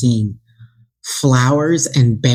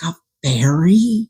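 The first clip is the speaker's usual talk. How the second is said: amazed; 6 semitones higher, slower than usual. Retold or imitated. Retold amazement.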